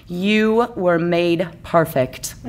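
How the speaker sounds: slow, tender reassurance; reassuring. Acted. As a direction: reassure her slowly and warmly. Ken reassures Barbie.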